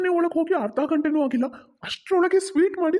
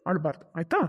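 low reassurance, questioning lift at the end; gentle coaxing; 8 semitones lower, 4 dB quieter than usual. Acted. Father soothing his daughter, gently coaxing. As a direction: coax her softly to agree.

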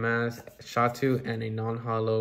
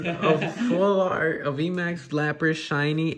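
excited; 6 semitones higher, 6 dB louder, slower than usual. Real, not acted. Announcing the big pull.

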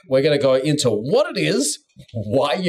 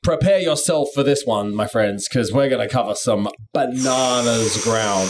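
dramatic announcer; 6 semitones lower than usual. Acted. Dramatic host build-up.

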